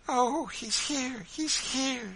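high, drawn-out cry of relief; exclaiming. Acted. Mother finding her boy, exclaiming. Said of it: "she exclaimed".

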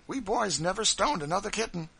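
put-on an excited boy reliving a fright; Master Bill. Son telling how mother whipped him, high and loud. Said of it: "he'd say".

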